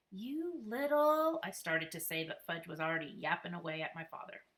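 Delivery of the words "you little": slow, drawn-out angry start; annoyed slow growl cut short; exasperated. A narrator's reading. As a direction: seethe at the little brother, slowly.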